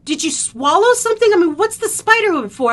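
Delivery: loud, high imitated questioning; acted alarmed brother; urgent questioning brother. Brother questioning crying child.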